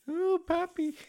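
high cooing mock of her; mock girlfriend coo. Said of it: "She'll be like".

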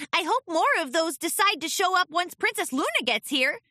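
put-on high, bright young filly voice; cheery filly. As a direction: chirp it hopefully, like a little pony. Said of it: "added Sweetie Belle cheerily".

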